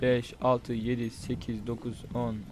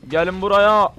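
higher, louder call to the horde; loud taunt; 4 semitones higher, 8 dB louder, slower than usual. Real, not acted. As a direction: yell at them to come get some. Taunting zombies to come. (yüksek sesle meydan okuma)

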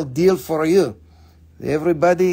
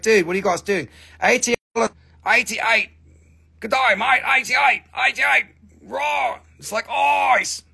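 rising into high, shouted Aussie hype; shouty Australian friend getting carried away; excited Australian; 5 semitones higher than usual. Retold or imitated. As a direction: play a rowdy Australian mate yelling prices. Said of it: "Okay, [name], calm down".

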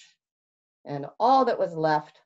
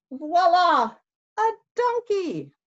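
high, loud voila; grand flourish; 12 semitones higher, 5 dB louder than usual. Acted. Fox promising Bear a donkey, high and showy. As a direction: finish like a magician's reveal.

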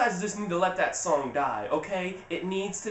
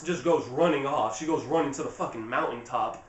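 ordinary delivery

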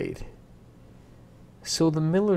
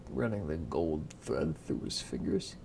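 ordinary delivery

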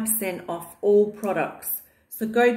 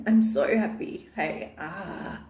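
ordinary delivery

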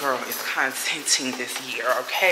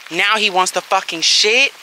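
real exasperation; 6 semitones higher, 8 dB louder than usual. Real, not acted. Dog delays walk in rain.

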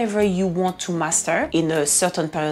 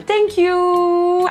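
high, drawn-out singsong thank-you; louder and much higher, stretched out sweetly; delighted thanks; 5 dB louder than usual. Real, not acted. Vlogger thanking a viewer for kind words, delighted singsong.

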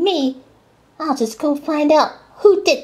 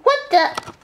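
shocked; 7 semitones higher than usual. Acted. Character finds his coaches derailed.

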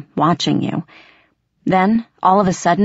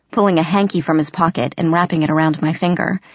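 ordinary delivery, nothing marked

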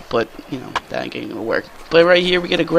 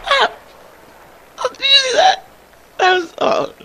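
real thrill, pitched way up; excited. Real, not acted. Gamer reacting to his own highlight play, excited. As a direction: voice jumps high at his own great play.

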